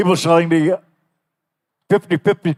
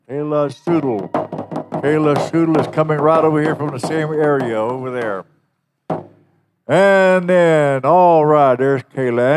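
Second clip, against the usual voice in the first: powwow emcee; 2 semitones higher, 5 dB louder than usual. Acted. Introducing princesses over the PA.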